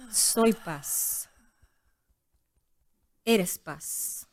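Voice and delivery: calm hushed meditation guide; yoga teacher voice. Shown as a demonstration. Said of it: "en tu voz de maestra de yoga".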